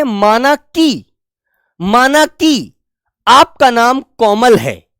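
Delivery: very slow, drawn-out माना कि, then a slow आपका नाम कोमल है; deliberate teacher's dictation pace; slow example sentence. Shown as a demonstration. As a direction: dictate it slowly, stretching माना कि. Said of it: "तो हम कहते हैं".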